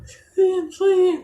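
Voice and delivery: high swish of palm fronds waved; swishing sound. Retold or imitated. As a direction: mimic the palms swinging loudly. Mimics waving palm branches. (bunyi kibasan daun palem tinggi)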